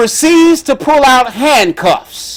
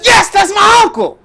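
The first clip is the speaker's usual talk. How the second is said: niece hollering; 11 semitones higher than usual. Retold or imitated. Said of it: "And she says".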